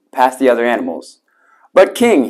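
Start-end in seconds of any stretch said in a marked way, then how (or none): 1.7-2.3 higher-pitched protest to the king; protesting cry. A narrator's reading. The python defends himself to the king, crying out. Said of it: "he cried".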